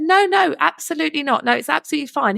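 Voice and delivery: mock protest, pitched high. Sisters politely refusing the worker's free drinks, high-pitched protest. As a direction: wave off the offer with a high laugh in the voice.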